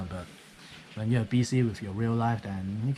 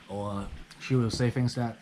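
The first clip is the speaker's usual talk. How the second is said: usual speaking voice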